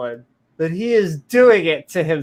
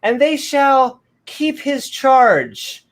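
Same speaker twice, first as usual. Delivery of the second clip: 1 mock scripture reading; 9 semitones higher, 3 dB louder, much slower than usual.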